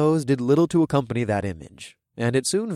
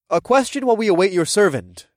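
raised, carrying request; loud voice; 8 semitones higher, 5 dB louder than usual. A narrator's reading. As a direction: speak up so the hall hears. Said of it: "I requested in a loud voice".

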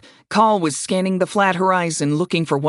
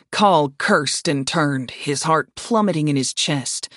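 usual speaking voice

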